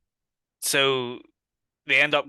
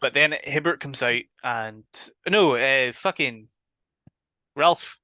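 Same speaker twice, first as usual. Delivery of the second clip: ordinary talk, nothing marked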